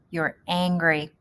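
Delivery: ordinary delivery, nothing marked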